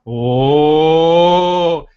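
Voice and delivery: the whole room reacting in delight; crowd gasp.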